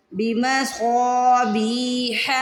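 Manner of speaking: بمصابیح with a heavy, stretched saad. Shown as a demonstration. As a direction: recite بمصابیح very slowly, each long vowel drawn out. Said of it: "بی کو کھینچنا ہے". (بمصابیح میں صاد کو موٹا اور کھینچ کر)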